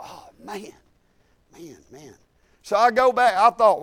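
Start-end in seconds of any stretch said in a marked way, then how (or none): 0.0-2.1 dismayed mumble. Retold or imitated. Inner dismay at returning money.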